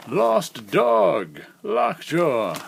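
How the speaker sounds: put-on quavery old-timer reading the cover; old man voice. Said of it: "why am I using my old man voice".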